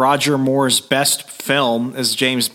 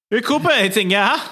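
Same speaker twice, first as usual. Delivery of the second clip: put-on German accent; 9 semitones higher than usual. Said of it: "let's make him German".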